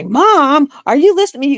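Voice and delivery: mock child tugging for attention; child calling out. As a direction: call up to a distracted mom. Kid calling out to parent.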